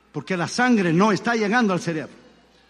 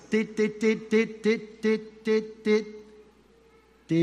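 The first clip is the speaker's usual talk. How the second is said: imitated beeps growing softer; fading monitor beeps; 4 dB quieter, much slower than usual. Last breath, monitor beeps fading softly. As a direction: let the beeps fade away. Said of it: "el monitor hace".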